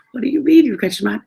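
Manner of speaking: high, loud, fast retold disbelief; amused, disbelieving comeback; incredulous. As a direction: fire back a baffled question. Retold laughing, incredulous reply.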